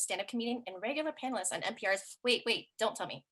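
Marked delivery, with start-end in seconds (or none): none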